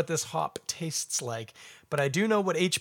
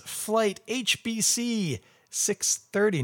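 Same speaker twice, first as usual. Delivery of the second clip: slow announcer read; 7 semitones higher, much slower than usual. Acted. Rereads label slowly.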